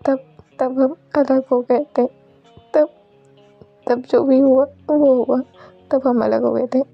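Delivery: lightly dramatized refusal to let go; acted desperate pleading. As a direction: beg your beloved to stay. Acted plea not to leave.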